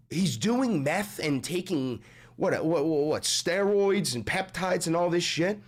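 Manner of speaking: ordinary talk, nothing marked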